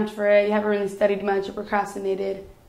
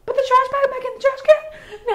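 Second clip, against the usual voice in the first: incredulous laughing; 3 dB louder than usual. Retold or imitated. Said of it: "I'm like".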